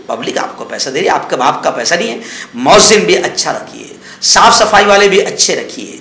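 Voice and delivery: ordinary talk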